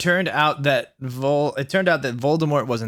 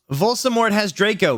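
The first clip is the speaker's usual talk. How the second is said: high, urgent shout; hammed-up alarm; 7 semitones higher than usual. A narrator's reading. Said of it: "we shouted at the same time".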